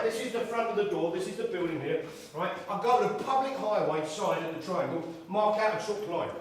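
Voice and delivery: normal talking voice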